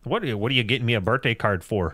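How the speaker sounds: put-on Minnesota accent. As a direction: ask like a puzzled Minnesotan. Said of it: "the Minnesota voice there".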